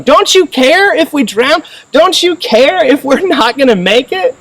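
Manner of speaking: high-pitched, drawn-out sassy demand. Acted. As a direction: give Jesus sass, slow and high. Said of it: "I hear the sass in their voice".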